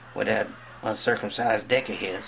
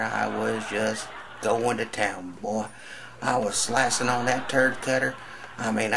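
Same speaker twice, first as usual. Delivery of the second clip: ordinary talk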